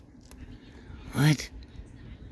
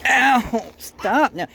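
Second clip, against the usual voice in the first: yelp of pain; 13 semitones higher, much slower than usual. Real, not acted. Dog bites him.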